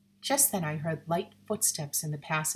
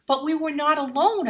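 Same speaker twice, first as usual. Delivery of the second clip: protesting; 9 semitones higher, 7 dB louder than usual. A narrator's reading. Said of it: "I protested".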